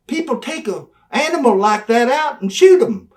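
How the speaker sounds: high, loud belittling rant; building tirade. Retold or imitated. Manager belittling Brian in the promo, loud high rant. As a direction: tear into him harder and harder.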